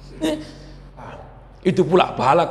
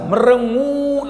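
MERENGUT stretched slowly, pitched higher; drawn-out emphasis; 6 semitones higher, much slower than usual. Shown as a demonstration. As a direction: draw out the second half of MERENGUT. Stretches the word for sullen faces. (kata MERENGUT dipanjangkan)

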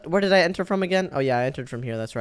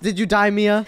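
ordinary talk

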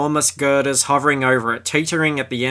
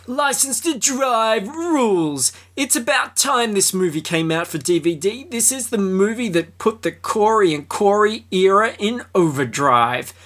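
high hammed-up fan voice; mock fan review read; 5 semitones higher than usual. Host reading a fan review, hammed up and high.

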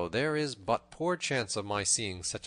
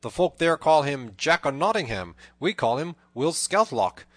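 loud, raised, lively declaiming; hearty proclamation; 5 semitones higher, 9 dB louder than usual. A narrator's reading. Robin reveals himself to the tanner, proclaiming loudly. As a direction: announce the names with a flourish.

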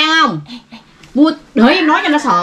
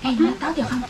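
quiet aside; 9 dB quieter, much faster than usual. Real, not acted. Aside after the phone threat.

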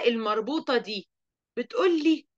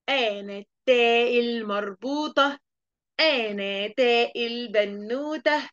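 slow, sing-song teasing letter voice; taa marbuta teasing; much slower than usual. Acted. Cheeky letter teasing the pupil, slow sing-song. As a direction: play the cheeky letter taunting slowly. Same letter character again.

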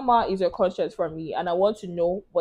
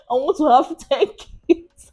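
slow, high, loud, breaking into laughter; laughing; 7 semitones higher, 6 dB louder, slower than usual. Real, not acted. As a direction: struggle to say it through laughter. Joking answer after laughing fit.

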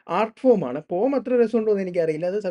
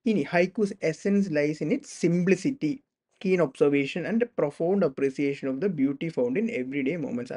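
slow dictation; 3 semitones lower, much slower than usual. Shown as a demonstration. Reads definition slowly.

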